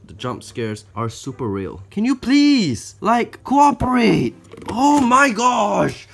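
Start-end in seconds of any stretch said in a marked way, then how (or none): 1.9-6.1 loud, high, drawn-out begging; stretched-out cry of exasperation; exasperated plea. Real, not acted. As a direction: wail it slowly in frustration. Pleads with uncooperative tarantula.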